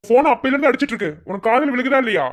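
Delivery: fast, high, loud telling-off; annoyed scolding. Acted. Friend scolding Saran about the ringing phone, fast and loud. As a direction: rattle it off in irritation.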